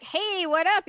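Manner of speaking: high, loud casual hey; a hint of a buddy calling out; mock cheery greeting. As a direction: toss out a breezy hello. Demon king greets cheerfully.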